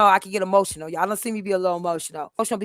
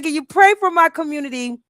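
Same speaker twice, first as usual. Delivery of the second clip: higher and louder, pressing the word pray; louder, higher earnest request; earnest plea; 8 semitones higher, 7 dB louder than usual. Retold or imitated. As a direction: insist on it, really asking. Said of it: "But real, I said".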